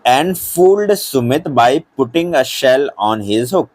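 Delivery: English answer read word by word; very slow and clear; teacher dictating for notebooks; slow dictation. Shown as a demonstration.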